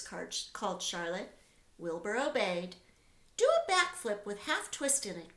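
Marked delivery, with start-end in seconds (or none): 3.4-5.4 higher command cry; cried out. A narrator's reading. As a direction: urge the pig on. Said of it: "cried Charlotte".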